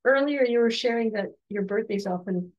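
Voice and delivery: ordinary talk, nothing marked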